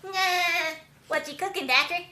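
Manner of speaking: high, loud cartoon question; cartoon character voice. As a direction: pipe up loudly like a nosy cartoon pal.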